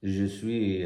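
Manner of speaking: a hesitant French accent on Je suis; attempted French accent. Shown as a demonstration. Podcaster trying a French accent for the listeners, half-hearted. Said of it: "how do you do French accent?".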